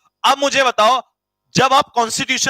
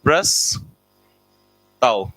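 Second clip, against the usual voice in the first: stretched slowly for writing down; slow dictation; much slower than usual. Shown as a demonstration. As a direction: drag out प्रस्ताव syllable by syllable. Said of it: "पहला meaning लिखो प्रस्ताव". (धीरे-धीरे खींचकर बोलना)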